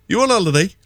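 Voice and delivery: put-on high-pitched posh English accent; quite posh. Fella in a Pennines pub to visitors, posh accent. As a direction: mimic a posh Southerner asking politely. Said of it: "Like, actually quite posh".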